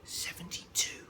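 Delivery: quick breathy whispered answer; breath the answer back unseen; whispered reply to a classmate. A narrator's reading. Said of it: "I whispered back".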